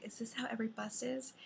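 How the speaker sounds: hushed, unvoiced whisper; whispered quote. First-time bus riders whisper to each other, scared whisper. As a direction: whisper it like a nervous passenger.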